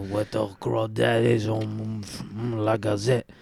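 put-on slow New Orleans accent; slow bayou drawl, New Orleans style. New Orleans accent, riffing in character for his cohost. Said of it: "New Orleans accent".

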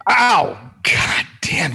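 sharp, high startled ow; pained yelp. Real, not acted. Friend startled by the bowl ringing out, sharp high yelp.

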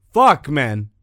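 ordinary talk, nothing marked

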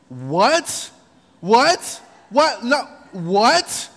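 rising, loud repeated what; stunned king. Retold or imitated. King learning his own guest is the villain, loud and stunned. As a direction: gape in disbelief.

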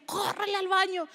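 a mother yelling at her daughter to run to the bathroom, urgent shout. Retold or imitated. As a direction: shout it like a panicked mom sending her kid running. Mom urging child to bathroom.

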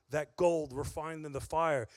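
ordinary delivery

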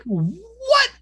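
very high, drawn-out incredulous "what"; retold disbelief. Recalling a friend's refusal to listen, incredulous. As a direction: squeal it in mock disbelief. Acting out own stunned reaction.

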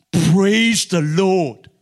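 loud, long-drawn praise shout; exultant shout. Retold or imitated. Doctor hearing God sent the applicant, exultant shout. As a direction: draw each word out and boom it. Retells the doctor's exclaimed reply.

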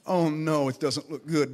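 hushed, worried mumble of a fretting farmer; a low, anxious farmer's mumble; nervous farmer. Retold or imitated. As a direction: mutter it low like a scared man watching dry fields. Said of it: "he's nervous".

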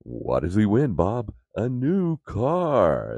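booming announcer reveal, stretched out; hammed-up prize announcer; game show host.

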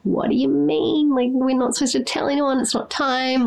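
high-pitched mock dismay; retold dismayed protest. Retold dismay at leaked pregnancy secret.

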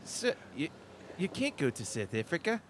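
put-on South African clerk; South African accent. Clerk refusing a ticket, South African accent. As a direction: play a hesitant South African official. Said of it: "jump in the South African".

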